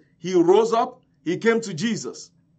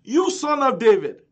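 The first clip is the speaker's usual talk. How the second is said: raised, higher-pitched clear appeal; crying out articulately; 5 semitones higher, 5 dB louder than usual. Retold or imitated. Blind man articulating his plea, crying out. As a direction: call out the words clearly and loudly. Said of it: "but then he was articulating".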